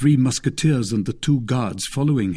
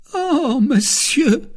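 very high, loud, slow wail; terrified servant; 5 dB louder, slower than usual. A narrator's reading. Planchet to his master after the poisoning, terrified. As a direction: wail it half dead with fright. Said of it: "stammered Planchet, more dead than alive".

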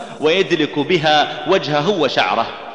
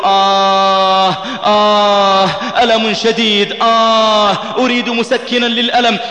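retold dying moans, drawn out and higher; broken, halting voice; 6 semitones higher, 4 dB louder, slower than usual. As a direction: groan the words out in broken pieces. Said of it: "بدأ يتكلم بصوت متقطع". (صوت متقطع متألم)

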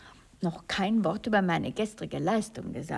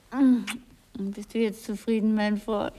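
ordinary talk

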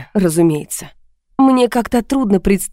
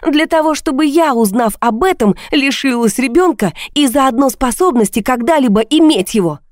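high-pitched, scathing tirade; mock the excuses with furious sarcasm; bitter, heated outpouring; sarcastic shouting; 7 semitones higher than usual. A narrator's reading. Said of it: "теперь и я кричала".

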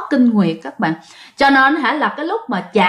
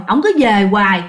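usual speaking voice

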